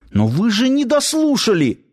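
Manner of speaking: high-pitched urgent protest; acted anxious outcry; hasty exclamation. A narrator's reading. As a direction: cut in with a high, urgent objection. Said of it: "поспешно воскликнул Нанос".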